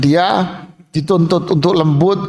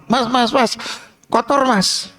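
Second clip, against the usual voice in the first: higher, insistent calling of the waiter; demanding customer; 7 semitones higher than usual. Acted. Diner calling the waiter about a dirty table, insistent. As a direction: call the waiter like a fussy boss.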